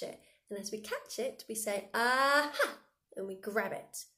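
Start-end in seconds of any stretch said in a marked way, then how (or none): 1.9-2.8 louder, higher triumphant aha. Shown as a demonstration. Teacher catches the fly with a triumphant aha. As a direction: crow it like you caught it. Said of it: "really overemphasize everything".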